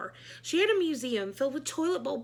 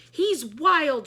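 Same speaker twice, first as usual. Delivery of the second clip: loud alarmed shout; 4 semitones higher, 9 dB louder than usual. A narrator's reading. Michael warning the class, a loud alarmed shout. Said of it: "shouted Michael".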